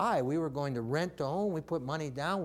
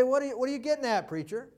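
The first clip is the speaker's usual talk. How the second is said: high, fast, mock-challenging question; skeptical listener; 8 semitones higher, 3 dB louder, much faster than usual. Preacher voicing his listeners' doubt, fast mock challenge. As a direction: voice the doubting congregation teasing the preacher. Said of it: "And you say".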